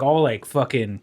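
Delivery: normal talking voice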